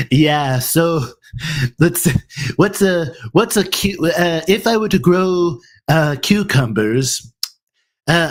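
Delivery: high-pitched cartoon bogan Aussie; Stewie bogan Australian accent. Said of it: "this is Stewie doing a bogan Australian accent".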